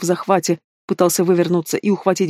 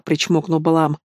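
plain reading voice

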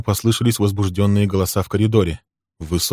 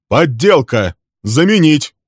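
louder, higher insistence; Bill repeating to Walter, firmer and louder; firm repeated demand; 8 semitones higher, 7 dB louder, slower than usual. Acted.

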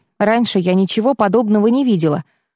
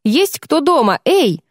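calling out; 5 semitones higher than usual. Acted. Calling out to unseen healers.

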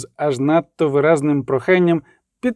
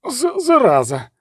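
higher, stuttered curse; a touch of a rattly, creaky croak; rattling voice; 7 semitones higher than usual. A narrator's reading. Deodat sitting up on the bier, rattling voice. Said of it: "деренчливо промовив Деодат".

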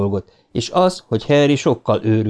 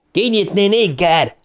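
much higher, raging bully bellow; 9 semitones higher than usual. A narrator's reading. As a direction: roar the order at the gate. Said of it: "bömbölte Henry". (dühös ordítás)

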